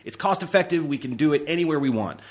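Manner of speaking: high, upbeat sales pitch; eager pitch-meeting voice. Retold or imitated. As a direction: sell it hard to the producers. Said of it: "when I would go into pitch meetings".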